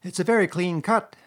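interested animal character; helpful rat. A narrator's reading. Rat examines the wound.

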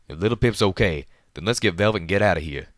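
shouting over battle noise. A narrator's reading. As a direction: yell it out mid-fight. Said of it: "Calamity shouted".

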